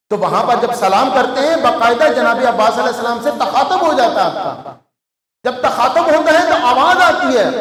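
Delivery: loud, high preaching with acted replies; full-force preaching. Preacher tells mourners of knocking at the shrine, loud and high. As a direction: preach hard, acting out the dialogue.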